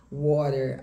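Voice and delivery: American "water", tapped T and sounded R; sotaque americano. Shown as a demonstration. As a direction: say "water" as "wah-der", American. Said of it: "em inglês americano e britânico". (sotaque americano, T batido e R final)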